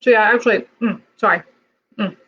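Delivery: talking with a mouthful; words round a bite of ice cream; mouth full. Real, not acted. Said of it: "You don't talk with your mouth full".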